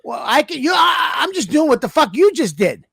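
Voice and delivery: high-pitched, exasperated protest; worked-up, half-shouted retort; indignant outburst. Real, not acted.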